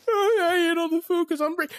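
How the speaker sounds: high, loud mocking voice; Rick. Storyteller mocking the cousin who ate everything, high taunting voice. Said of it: "Rick is over there laughing".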